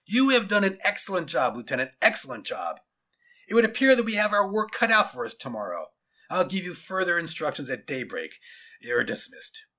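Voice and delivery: loud, emphatic praise; satisfied general. Acted. General praising and dismissing his lieutenant, authoritative. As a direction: declare it with a commander's authority.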